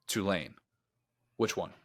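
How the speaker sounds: ordinary talk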